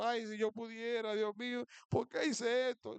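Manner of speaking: high-pitched imitation of weeping regret; mock tearful lament. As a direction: lightly act out a tearful lament.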